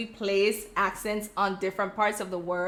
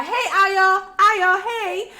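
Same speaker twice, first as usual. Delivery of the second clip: high, loud imitation of a friend's greeting; coworker calling out; 9 semitones higher, 7 dB louder, much slower than usual. Coworker hailing her by nickname, high excited call. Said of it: "she would call".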